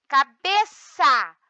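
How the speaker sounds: "Cabeça" slow, loud, with "be" drawn out; stressed syllable drawn out. Shown as a demonstration. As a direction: stretch "Cabeça" to show its stressed "be". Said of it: "a penúltima". ("Cabeça" lenta, com ênfase no "be")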